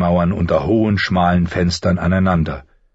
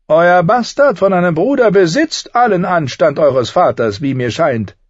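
higher, sly and needling; a higher, sardonic little man's voice; mocking dwarf Tyrion; 12 semitones higher, 5 dB louder than usual. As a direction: talk down to a would-be lord with cool mockery.

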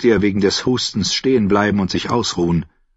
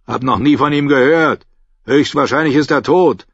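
higher, louder, dismissive guard voice; gruff guard; 6 semitones higher, 4 dB louder than usual. Acted. Guard dismisses the knight at the gate. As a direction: brush off the drunk.

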